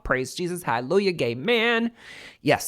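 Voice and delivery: high, gleeful mock hallelujah; joyful praise. Real, not acted. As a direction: shout for joy, playfully. Celebrating loan award.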